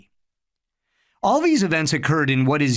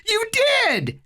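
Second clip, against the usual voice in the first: put-on child squall. A narrator's reading.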